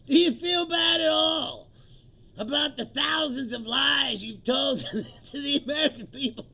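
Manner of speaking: hammers Trump with the question; mock reporter shout. Comic imitating the reporter grilling Trump loudly.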